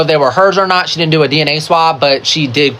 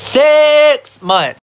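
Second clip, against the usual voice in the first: six months, higher and louder; emphatic repeat; 10 semitones higher, 3 dB louder than usual. Real, not acted. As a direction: punch it in disbelief. Repeats for disbelief.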